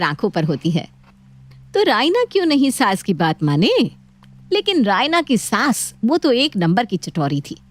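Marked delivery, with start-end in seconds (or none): none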